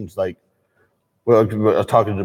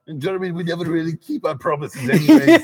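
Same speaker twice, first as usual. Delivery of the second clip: bad German accent; 7 semitones higher than usual. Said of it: "That is the worst German accent I've ever, ever heard".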